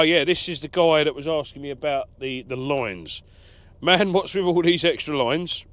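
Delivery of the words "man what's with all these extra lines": louder, higher mock American accent; put-on American accent, pitched up and loud. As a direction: read it out like an American. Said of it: "In an American accent".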